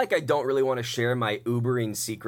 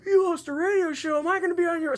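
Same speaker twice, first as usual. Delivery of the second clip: put-on much higher excited drunk voice; mock eager. Drunk passenger learning about the radio show, high eager mock. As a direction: gush like a starstruck drunk fan. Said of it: "drunk passenger excited about radio show".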